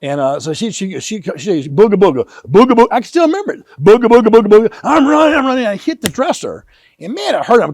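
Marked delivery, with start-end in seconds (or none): 1.7-4.8 loud booga-booga boogeyman growl; playful monster chase. Retold or imitated. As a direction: scare the kid in fun.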